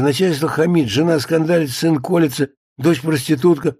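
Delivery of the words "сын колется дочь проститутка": usual speaking voice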